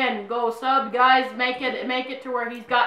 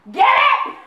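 teasing punchline; 13 semitones higher, 7 dB louder than usual. Real, not acted. Repeats her name joke.

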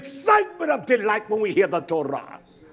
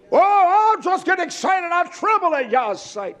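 high, loud hammed-up gushing; mock excitement; 9 semitones higher, 4 dB louder than usual. Preacher mimics people claiming to tremble at Yah, mock excited. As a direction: burst out in fake rapture, then ease down.

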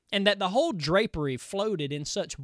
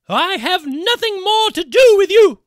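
high, loud frightened exclamation; terrified cry; 12 semitones higher, 13 dB louder than usual. Acted. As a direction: cry out, recoiling in terror. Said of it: "she shrank from him in terror and exclaimed".

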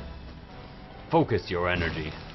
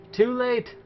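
sing-song taunt; 12 semitones higher than usual. Pretend. Taunting repeat.

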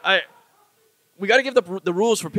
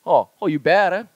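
Hawaiian pidgin come-on with a questioning lift; lightly put-on pidgin, louder; pidgin pickup line; 4 dB louder than usual. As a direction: pidgin flirting, tagged as a question. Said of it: "like pidgin pickup lines".

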